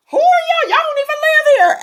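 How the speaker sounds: high, loud mock challenge to outsiders; suspicious local challenge. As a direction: squeak up in disbelief at strangers. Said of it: "looked like".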